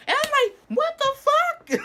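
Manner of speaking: very high, loud, stretched-out shriek; shocked shriek. Retold or imitated. Woman grabs my hand, retold shocked shriek.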